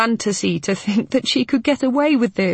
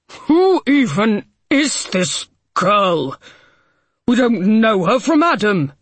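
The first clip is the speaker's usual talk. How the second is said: angry; 4 semitones higher, 5 dB louder than usual. A narrator's reading. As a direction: spit it out in outrage. Harding furious at stranger heir.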